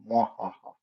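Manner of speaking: mock villain cackle; evil laugh. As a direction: gloat with a hammy evil laugh. Gloating evil laugh at dip.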